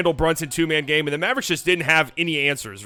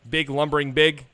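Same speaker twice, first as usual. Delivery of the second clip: ordinary delivery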